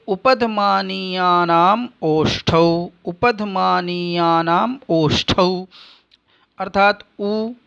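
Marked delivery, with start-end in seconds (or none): 0.1-5.7 slow, deliberate chant of ऋतुरसाणाम मूर्धा; sutra recitation. Shown as a demonstration. Teacher reciting the Sanskrit rule slowly for students. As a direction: lay out each syllable of the sutra slowly.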